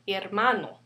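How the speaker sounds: "hermano" stressed on MA; stress on second-to-last syllable. Shown as a demonstration. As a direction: say "hermano" as her-MA-no. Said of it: "the second to the last syllable would be".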